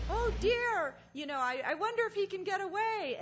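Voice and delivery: put-on South African accent. Speaker imitating woman watching the calf, high loud South African accent. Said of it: "you hear the woman going".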